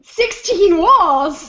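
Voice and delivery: slow, loud, drawn-out exclaim; excited shout. Real, not acted. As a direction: belt it out slowly in glee. Joking exaggeration shouted out.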